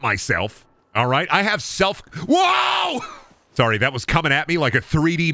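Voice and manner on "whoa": high sudden yelp; startled. Real, not acted. Startled by something coming at him onscreen. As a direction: jump at it.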